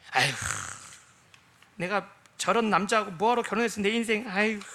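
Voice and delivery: a lightly whiny wife bemoaning her marriage; mock lament. Wife lamenting her husband to God, mock whine. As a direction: sigh out a hammed-up aigo.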